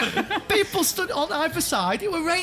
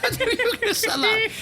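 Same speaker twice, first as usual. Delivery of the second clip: high, helpless laughing protest; real laughter breaking up the words; 7 semitones higher, faster than usual. Real, not acted. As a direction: talk through a fit of laughter. Cracks up at own trainer line.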